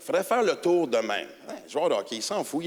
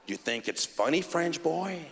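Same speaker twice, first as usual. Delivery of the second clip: low menace; 3 semitones lower, 5 dB quieter, slower than usual. Retold or imitated. Cop menacing him.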